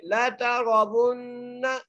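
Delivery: very slow chant, thick ra in तरवुन्नल; nasal ghunna on the doubled nun; heavy ra. Shown as a demonstration. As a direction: recite ला तरवुन्नल जहीम melodically. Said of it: "गुन्ना करेंगे".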